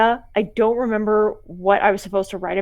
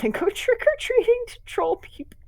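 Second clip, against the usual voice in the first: laughing whisper; 14 semitones higher than usual. Real, not acted. Laugh while reading.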